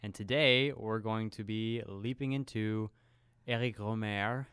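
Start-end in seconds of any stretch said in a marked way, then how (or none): 3.5-4.4 French accent. Shown as a demonstration. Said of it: "for those who can't understand my French accent".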